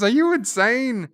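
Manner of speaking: high, loud horrified yell; shocked shout. Retold or imitated. Dukat yelling at Weyoun after he drinks poison, shocked shout. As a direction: bark it out in disbelief.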